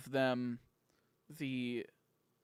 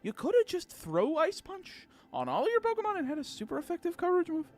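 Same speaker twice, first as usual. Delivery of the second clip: mocking; 8 semitones higher than usual. Mocking a gym leader.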